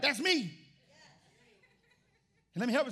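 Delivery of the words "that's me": loud, high eager answer; eager congregant. Retold or imitated. Pastor voicing the congregation's loud answer. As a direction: call out like a keen believer. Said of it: "Every hand should be like".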